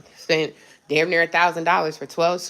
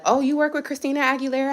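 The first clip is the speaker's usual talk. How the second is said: high, wide-eyed impressed asking; impressed interviewer; 8 semitones higher, faster than usual. Pretend. A recruiter reading her fake resume, high and wide-eyed. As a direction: play the amazed recruiter. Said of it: "They gonna be like".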